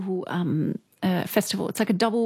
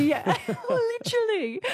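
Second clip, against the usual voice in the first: high squeaky laugh through words; 12 semitones higher, slower than usual. Real, not acted. Laughing at the funny text.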